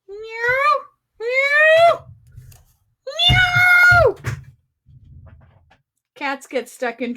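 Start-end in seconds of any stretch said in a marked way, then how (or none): 0.1-4.2 high meows rising into a yowling cry; loud cat meows. Acted. Host performing cat cries for viewer Kathy, rising yowls. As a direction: yowl louder and higher each time. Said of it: "Here you go, Kathy".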